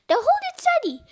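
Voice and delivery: high, loud urgent order; yelled command. A narrator's reading. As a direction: shout instructions over the panic. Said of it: "yelled Fluffy".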